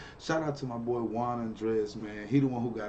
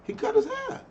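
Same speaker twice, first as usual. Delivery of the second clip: shocked squeal, much higher and louder; surprised; 9 dB louder than usual. Real, not acted. Host gasps at the guest's new haircut, surprised squeal. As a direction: blurt it out in disbelief. Reacting to Joey's haircut in clip.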